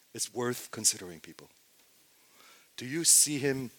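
ordinary delivery

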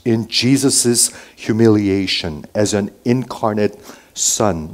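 ordinary delivery

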